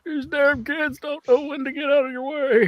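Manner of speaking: put-on high, cranky old man voice; the old driver complaining about the kids; grumpy old man. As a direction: grumble like a geezer at the wheel.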